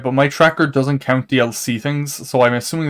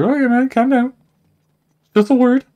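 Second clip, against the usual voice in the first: much higher mock-pleading voice; retold childlike protest; 11 semitones higher, 4 dB louder than usual. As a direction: squeak it out as a retold plea to calm down. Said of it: "it was, like, a child now".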